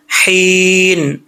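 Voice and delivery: very long हीन with h from the throat. Shown as a demonstration. Teacher shows the letter's origin, careful articulation. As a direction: breathe हीन from throat, not chest. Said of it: "हल्क से है".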